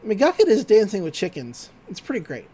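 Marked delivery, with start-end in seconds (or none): none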